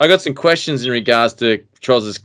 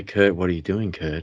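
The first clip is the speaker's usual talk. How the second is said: spooky camera voice; 5 semitones lower, 9 dB quieter than usual. Retold or imitated. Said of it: "if you hear it say".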